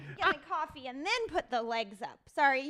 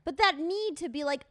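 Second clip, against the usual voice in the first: ordinary delivery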